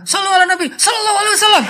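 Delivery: menirukan salawat dengan marah, nada sangat tinggi; salawat sambil marah. Retold or imitated. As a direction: bersalawat melengking seperti masih marah. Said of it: "ada juga yang sambil marah". (menirukan salawat marah bernada tinggi)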